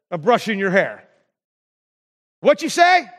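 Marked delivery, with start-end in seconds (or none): 2.4-3.1 louder, higher call of not hearing; acted-out old man asking for a repeat; hard-of-hearing old man. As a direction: call out as if you missed it.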